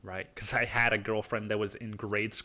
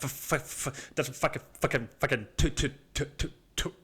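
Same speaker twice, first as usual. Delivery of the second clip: stuttered bursts breaking down into little noises; tongue-tied stammering; 3 semitones higher than usual. Retold or imitated. Addict side tries to buy drugs, imitated helpless sputter. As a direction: mimic sputtering, unable to get words out. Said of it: "Like, you can't even fucking talk".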